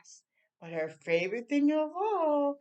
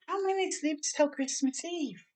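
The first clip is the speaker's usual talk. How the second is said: higher, eager little voice; eager child question; 8 semitones higher than usual. Acted. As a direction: ask like a kid who can't wait. Excited child asks mother.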